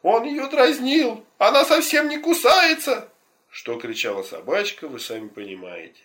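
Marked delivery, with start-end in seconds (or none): 0.0-3.1 very high, loud and slow protest; lady's indignant shout. Acted. Dog's owner shouting to the crowd defending her dog, high loud slow protest. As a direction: shriek it out as the offended dog owner. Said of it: "Собачкина хозяйка кричала".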